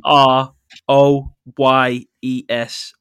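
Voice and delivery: letter by letter, slowly; spelled out slowly. Shown as a demonstration. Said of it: "which is spelled T".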